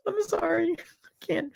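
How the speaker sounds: apologizing through giggles; can't stop laughing as you say sorry; giggling apology. Real, not acted. Apologizing to cousin Stephanie, giggling. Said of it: "I'm not making fun of you".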